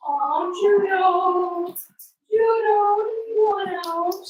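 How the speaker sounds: high, slow sing-song coo; talking to a dog. Real, not acted. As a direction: croon to the puppy. Cooing at a puppy.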